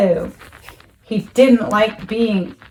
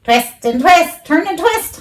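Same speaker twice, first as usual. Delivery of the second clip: lightly voiced goose, higher; a goose honking advice; 7 semitones higher than usual. A narrator's reading. Goose urging Wilbur on, lightly honked. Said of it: "honked the goose".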